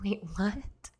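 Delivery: can't believe it, just whisper it; stunned whisper. Retold or imitated. Traveller stunned the agent gives her his ticket, whispered disbelief.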